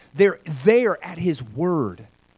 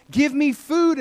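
lightly acted hungry chick, higher; baby bird crying out; 10 semitones higher, 4 dB louder, slower than usual. Baby robin calls to its mom for food, crying out. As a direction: plead from the nest in a raised pitch. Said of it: "It's crying out to its mom".

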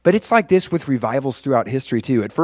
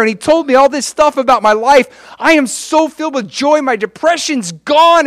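high, loud, breathless-style gushing testimony; buzzing with excitement; 11 semitones higher, 6 dB louder than usual. Acted. Convert begging family to come along, buzzing with excitement. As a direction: pour it out, bursting to convince. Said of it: "they were so buzzing".

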